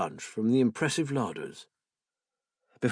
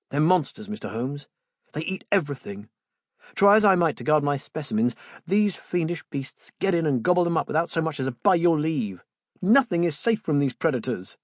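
heated rant; 5 semitones higher, 4 dB louder than usual. Acted. Joe rails against goats.

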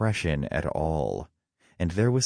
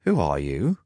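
pointed question, rising at the end; suspicious challenge; demanding; 4 dB louder than usual. A narrator's reading. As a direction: demand it abruptly of a stranger. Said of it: "Loki demanded".

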